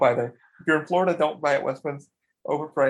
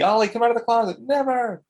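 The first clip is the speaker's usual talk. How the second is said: high, loud playful call and refusal; hammed-up calling out; joking mock exchange; 7 semitones higher, 4 dB louder than usual. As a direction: act out a silly joke, pitched up. Acting out the closet joke.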